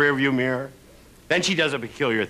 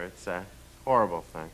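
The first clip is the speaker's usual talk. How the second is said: ordinary talk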